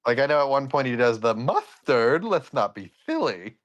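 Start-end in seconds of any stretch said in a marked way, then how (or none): 1.3-3.6 lisping, swooping cartoon eccentric; Disney's Mad Hatter. Eccentric hatter rejects an ingredient, lisping. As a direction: lisp it with a swooping pitch. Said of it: "You do a really good Mad Hatter".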